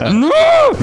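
high, loud, laughing cry of No; losing it. Retold or imitated. Back at the gate, losing it over the delay, high laughing loud cry. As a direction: burst out in a laughing wail. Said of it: "I just lost it".

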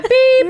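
high-pitched beep like a car horn; mock honk, squeaked out high; taxi horn beep. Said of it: "He's like".

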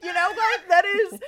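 laughing high and loud; cracking up while talking. Real, not acted. Laughing high excited outburst.

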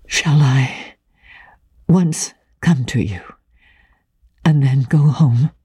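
hushed, halting proposal; low and hesitant, clearly spoken. A narrator's reading. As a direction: venture it carefully, pausing between phrases. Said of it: "in a low, clear voice".

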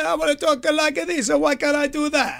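put-on Italian accent. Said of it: "worst stereotypical Italian accent".

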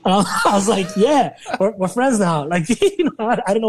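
can't keep the laugh out of it; amused laugh while telling the punchline. Real, not acted. Laughing recounting the phone friendship.

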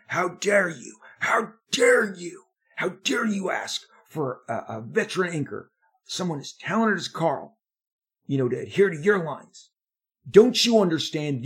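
high, loud mock scream of an angry editor; retold screaming. Editor screaming down the phone at young penciler, high and loud. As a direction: shriek it like the furious editor did. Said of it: "he screams at me".

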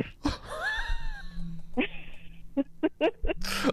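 real helpless shriek of laughter. Real, not acted. Caller shrieks with laughter at her own story.

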